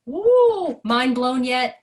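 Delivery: playful teasing cheer; excited whoop. Real, not acted. Teacher cheering the class.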